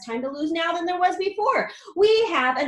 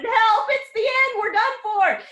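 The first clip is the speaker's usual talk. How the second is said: high, loud cry for help; panicked shout; 11 semitones higher, 8 dB louder, slower than usual. A narrator's reading. Grandpa Joe yelling as the roof breaks. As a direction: yell in panic. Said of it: "Grandpa Joe shouted".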